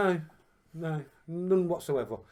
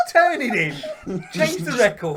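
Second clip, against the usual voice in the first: louder, laughing exasperation; 6 semitones higher, 7 dB louder, much slower than usual. Real, not acted. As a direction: tease him through laughter. Teasing Ethan while laughing.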